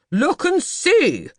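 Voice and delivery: very slow, loud, high roar. Acted. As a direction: bellow it at her. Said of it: "he roared at her".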